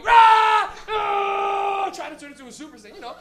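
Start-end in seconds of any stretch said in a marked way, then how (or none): 0.0-1.9 loud, high, long roar; kid's power-up yell. Retold or imitated. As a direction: imitate a kid straining to power up. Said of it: "little kid, just going".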